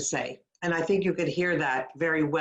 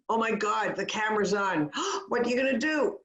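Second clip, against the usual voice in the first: mock panic, higher with a gasp; nervous; 4 semitones higher, faster than usual. Coach playing someone whose camera is on, nervous. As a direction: act out gasping nerves as it rushes on. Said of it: "If a person is nervous".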